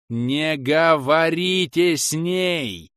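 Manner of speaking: high, drawn-out warning; urgent yell. A narrator's reading. Inquisitor breaking up the curse, drawn-out yelling. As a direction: yell each word out slowly. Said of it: "Я орал".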